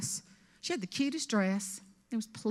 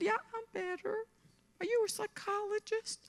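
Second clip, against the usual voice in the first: mock meek reply; 11 semitones higher, 7 dB quieter than usual. Woman's voice, now calmed.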